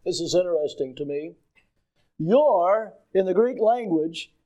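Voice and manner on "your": "Your" drawn out and stressed; emphatic your. Shown as a demonstration. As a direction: lean hard on the word "Your". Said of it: "It means with emphasis".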